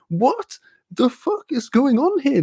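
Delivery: high, slow incredulous cry; mock disbelief. Reacting to constant news of killings, high slow disbelief. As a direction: stretch it out in disbelief.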